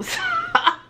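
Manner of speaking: sudden high squeal of a laugh; burst of high laughter. Real, not acted. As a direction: break into a high giggle. Laughs at her own joke.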